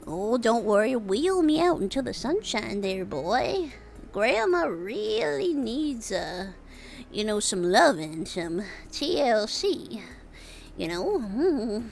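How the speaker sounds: slow, lightly voiced old granny; grandma voice. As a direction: ask sweetly like a frail grandma. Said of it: "And I said".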